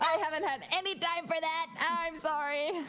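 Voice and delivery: high-pitched, half-laughing excuse; sheepish apology. Retold or imitated. Student excusing herself to the voice teacher, sheepish. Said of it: "And I'm like".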